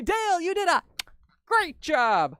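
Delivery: high, loud gushing praise; mock fan comment, bright and loud; imitating a fan. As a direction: gush like an excited viewer. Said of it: "leave a comment and say".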